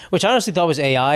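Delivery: ordinary talk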